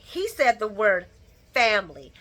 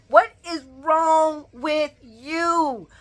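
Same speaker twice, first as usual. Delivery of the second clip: slow, drawn-out exasperated question; exasperated scold; 6 semitones higher, 4 dB louder, much slower than usual. Real, not acted. Scolding Eden on screen, slow and exasperated. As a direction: stretch it out in disbelief.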